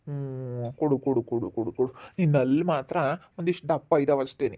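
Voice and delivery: put-on drunken sleep mumbling. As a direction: mumble half asleep, lips barely moving. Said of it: "ಯಾಕೆ ಹಿಂಗ್ ಅಂಬ್ಲಿಸ್ಕೊಂತಾನೆ". (ನಿದ್ದೆಯಲ್ಲಿ ಅಸ್ಪಷ್ಟ ಗೊಣಗಾಟ)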